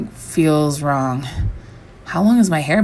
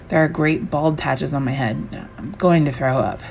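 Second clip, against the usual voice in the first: ordinary delivery, nothing marked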